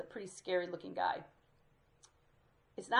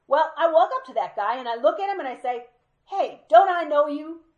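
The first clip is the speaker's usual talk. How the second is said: much louder and higher, booming at the checkout; loud storytelling; 8 semitones higher, 16 dB louder than usual. Retold or imitated. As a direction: tell it loud enough for the whole line. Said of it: "in what you might call a loud-ass voice".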